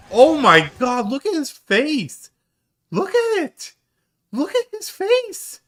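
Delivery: very high, loud squealing disbelief; high-pitched loud incredulous squeal; astonished shrieking. Real, not acted. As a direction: shriek it, cracking up at his face. Laughing at drunk friend's face.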